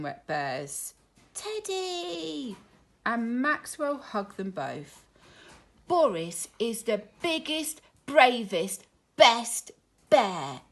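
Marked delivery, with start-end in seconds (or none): 1.3-2.6 put-on a child hugging his toy; little boy voice. Maxwell's joyful cry. 5.9-10.6 louder and louder praise; growing cheer. Acted. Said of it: "said Maxwell".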